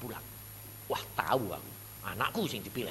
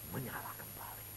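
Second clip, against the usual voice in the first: whisper; 9 dB quieter than usual. Acted. Preacher whispering to the church.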